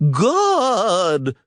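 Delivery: high, long yell for the guard; mild mock yell. A narrator's reading. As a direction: call the guard in outrage. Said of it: "the purple one yelled".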